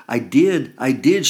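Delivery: high, loud desperate shriek. A narrator's reading. Mother shrieking at her caregiver daughter, high and loud. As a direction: shriek it back in protest. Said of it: "she shrieks".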